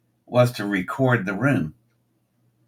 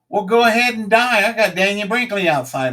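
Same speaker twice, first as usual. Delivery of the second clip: loud, much higher mock bellow; comic shout; 9 semitones higher, 6 dB louder than usual. Comedian shouting a joke at a breathless audience member, loud and high. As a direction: belt the joke at the gasping woman. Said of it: "I said".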